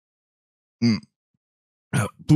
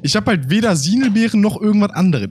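ordinary talk, nothing marked

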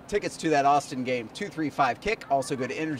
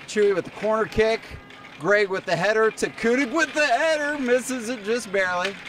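higher, rising highlight call; excited play-by-play; 7 semitones higher, 3 dB louder than usual. Acted. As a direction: build pitch with the attack to a near miss.

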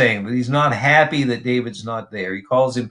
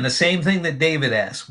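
ordinary talk

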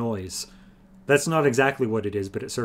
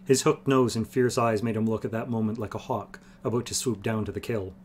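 plain reading voice

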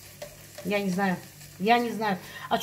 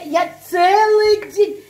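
drawn out; 5 semitones higher, 5 dB louder, much slower than usual. Real, not acted. Exasperated over lost part.

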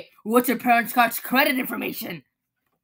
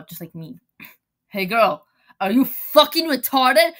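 usual speaking voice

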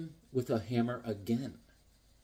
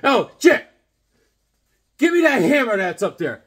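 brother calling up; 7 semitones higher, 9 dB louder than usual. Retold or imitated. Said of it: "He's like".

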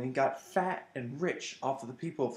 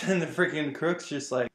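ordinary delivery

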